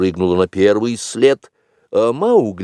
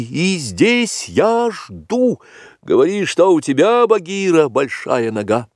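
raised voice; 12 semitones higher, 4 dB louder than usual. A narrator's reading. Mowgli calling to Bagheera near the waterfall, voice raised. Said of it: "повышая голос".

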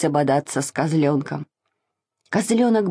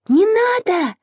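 put-on high, alarmed little-girl squeal; 13 semitones higher than usual. A narrator's reading. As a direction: squeal a plea to stop, pitched way up. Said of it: "завизжала Маша". (испуганно, с визгом)

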